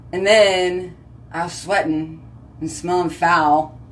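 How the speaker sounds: normal talking voice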